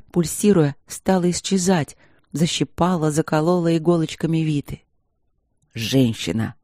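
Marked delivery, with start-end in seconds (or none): 5.7-6.6 low warrior voice. A narrator's reading. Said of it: "повторил воин".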